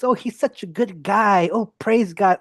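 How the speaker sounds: high, cooing and gushing; mock sweet baby talk. Mocking sweet voice for viewers who want things sugarcoated. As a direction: coo it like soothing a baby, voice up high. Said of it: "treat you like a little baby and go".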